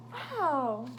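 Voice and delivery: plain reading voice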